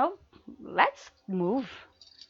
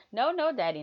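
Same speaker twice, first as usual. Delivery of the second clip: teasing; 8 semitones higher, 5 dB louder, faster than usual. Acted. Teasing refusal in roleplay.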